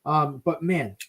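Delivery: ordinary delivery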